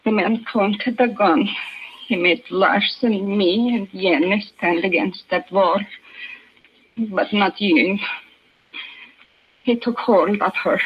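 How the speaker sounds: put-on shaken mother in a Swedish accent. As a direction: recount it haltingly, Swedish vowels. Mother recounts the assault.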